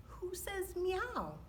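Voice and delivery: plain reading voice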